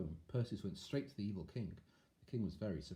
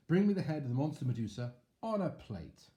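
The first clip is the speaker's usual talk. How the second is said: king voice; 5 semitones higher, 5 dB louder than usual. Acted. Evil king gives order.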